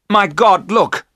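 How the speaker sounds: loud, high cry of horror; terrible cry. A narrator's reading. Jonathan spotting the Count in London, crying out in horror. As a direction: shout out in sudden alarm. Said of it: "Jonathan gave a terrible cry".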